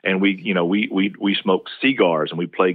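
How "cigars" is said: "cigars" as SEE-gars, first syllable strongest; Southern initial stress. Shown as a demonstration. Linguist joking on Southern habit, first syllable of cigars stressed. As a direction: punch a long SEE in "cigars". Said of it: "we put a lot of stress".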